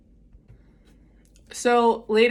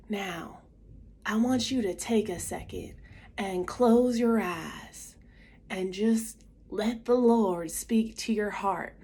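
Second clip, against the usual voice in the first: slow, softer Southern preacher imitation; strong Southern accent; 3 dB quieter, slower than usual. Southern priest guides teens at closing mass, slow Southern drawl. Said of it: "he had a strong Southern accent".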